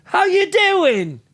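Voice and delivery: loud, high, drawn-out New York greeting; big hammed-up New York hello; mock New York accent. Showing up from East Ridge, mock New York accent. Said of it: "imagine if I showed up".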